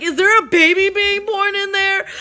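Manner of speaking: imitating a brother's high, loud yell; high-pitched incredulous shout, re-enacted; retold yelling. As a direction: yell the question through a door. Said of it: "my brother's right there also yelling".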